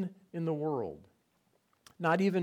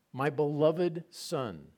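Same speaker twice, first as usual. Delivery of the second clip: ordinary talk, nothing marked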